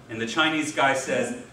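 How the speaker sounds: normal talking voice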